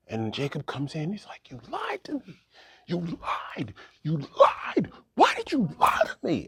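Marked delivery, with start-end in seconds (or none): 1.5-2.4 high, unvoiced hissed accusation; hissed whisper. Jacob confronting Laban. 2.9-6.5 rising from tight to louder angry demand; building anger, pressing the question; angry accusation. Acted. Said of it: "And he's angry".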